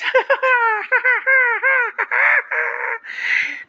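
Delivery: long high-pitched giggling laugh; falsetto laugh. Shown as a demonstration. Laughing at the ridiculous voice, in falsetto. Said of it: "this is the voice on falsetto".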